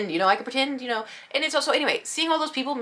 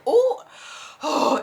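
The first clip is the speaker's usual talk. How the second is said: much higher startled oh; taken aback oh; 8 semitones higher, 3 dB louder than usual. Retold or imitated. Fan hearing no hugs allowed, startled high oh. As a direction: caught off guard. Surprised by no-hug rule.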